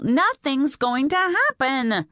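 put-on high, drawn-out teenage insistence; exasperated protest. A narrator's reading. Daughter dismissing mother's fears, high drawn-out protest.

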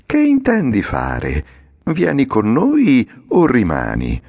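a hint of the little man's honeyed coaxing, drawn out slowly; all compliments. A narrator's reading. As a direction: sweet-talk the puppet, slow and syrupy. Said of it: "tutto complimentoso". (l'omino tutto complimentoso, mellifluo e lento)

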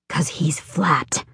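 slow, spooked whisper. Acted. Mary whispers to Rascal about the flat lamb, spooked whisper. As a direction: breathe it out, creeped out. Scared Mary explains quietly.

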